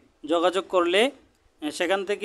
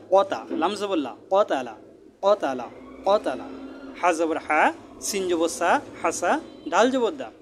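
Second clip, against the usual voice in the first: sing-song recitation, rising on each syllable; deliberate Quran-class chanting cadence; spelling drill. Shown as a demonstration. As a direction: spell it out, then land the joined word with a fall. Spells out joined words as drill.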